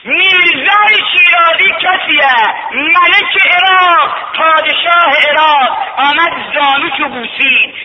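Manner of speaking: high, loud chanted preaching; soaring rowzeh-style sermon chant; preaching at full force. Acted. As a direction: lift the journey into a ringing chant.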